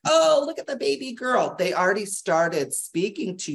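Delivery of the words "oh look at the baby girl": put-on cooing at a baby. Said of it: "somebody might have been like".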